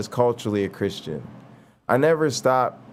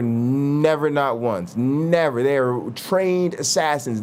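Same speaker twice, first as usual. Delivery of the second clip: ordinary talk, nothing marked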